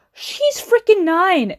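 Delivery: high, slow, drawn-out indignation; indignant, stretched for emphasis; exasperated outburst. Real, not acted. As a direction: burst out in exasperated disbelief. Exasperated rant about the episode.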